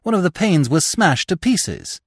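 ordinary delivery, nothing marked

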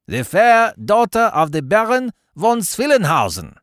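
high, declaimed Baron's voice; condescending explanation. A narrator's reading. Baron condescends to explain his toast, lofty high voice. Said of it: "condescending to explain".

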